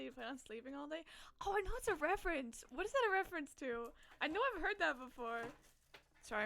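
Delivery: normal talking voice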